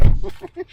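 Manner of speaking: a sudden loud high burst of laughter. Real, not acted. Mother laughing with the kids racing the others, loud burst of laughter.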